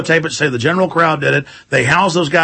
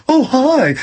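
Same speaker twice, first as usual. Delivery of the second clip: higher, sweet mock greeting; mock cute guy; 8 semitones higher than usual. Host mocks male anchor greeting co-host, sweetly higher. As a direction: greet her like a smooth flirt. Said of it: "he's like".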